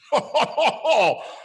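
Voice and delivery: a hearty Santa belly laugh; Santa's laugh. A narrator's reading. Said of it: "But I heard him exclaim".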